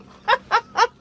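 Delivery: loud squeaky laugh; high happy laugh. Real, not acted. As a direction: burst out laughing with delight. Laugh after tasting.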